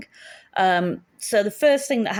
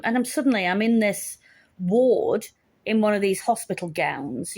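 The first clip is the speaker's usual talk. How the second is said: usual speaking voice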